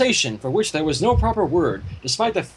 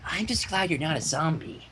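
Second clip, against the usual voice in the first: soft Fluttershy; 2 semitones lower, 7 dB quieter than usual. A narrator's reading. Fluttershy relieved after hug.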